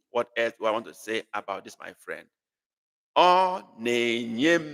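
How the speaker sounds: ordinary talk, nothing marked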